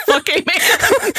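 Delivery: "Fuck hey man" said through laughter, high; laughing mispronunciation. Retold or imitated. Podcast host cracking up repeating the misread name. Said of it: "How was he pronouncing it".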